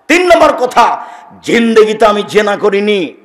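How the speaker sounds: loud, high fiery sermon cry; thundering preacher's climax; preaching at full force. Acted.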